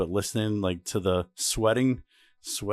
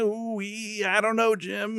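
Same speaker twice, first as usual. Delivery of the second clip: much higher excited commentator squeal; Tony Romo voice; 13 semitones higher, slower than usual. Retold or imitated. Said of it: "That's definitely Tony Romo".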